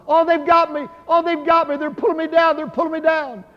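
high, loud cries of a man being dragged under; screaming retelling. Retold or imitated. Dying brother screaming from his hospital bed, retold as high panicked cries. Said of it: "he was screaming to the top".

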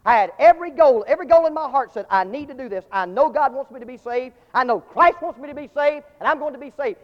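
loud, rising declaration of intent; earnest resolve. Retold or imitated. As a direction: build it up with conviction. The boy's resolve, retold.

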